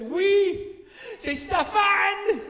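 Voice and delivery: high, loud, slow blaming cry; a kid shouting out a scapegoat; four-year-old blaming someone else, high and drawn out; eager little boy. Retold or imitated. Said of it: "he literally said".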